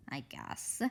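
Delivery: barely whispered give-in; reluctant. Acted. Hanny reluctantly agreeing to go along. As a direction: murmur it, unwilling.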